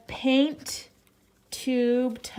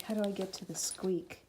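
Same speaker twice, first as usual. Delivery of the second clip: voicing the dog; 5 semitones lower, 9 dB quieter, faster than usual. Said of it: "he's like".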